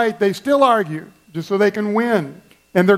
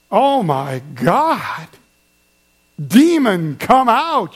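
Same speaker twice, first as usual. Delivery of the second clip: shocked disbelief; much slower than usual. Retold or imitated. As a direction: act floored by the outburst. Stoic spouse shocked by screamer.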